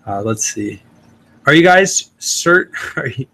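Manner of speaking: ordinary talk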